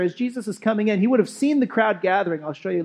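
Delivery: usual speaking voice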